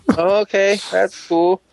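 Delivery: put-on high, sarcastic childlike voice; mock whiny fan. Mocking a sulky fan, high sarcastic voice. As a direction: sneer it sweetly high.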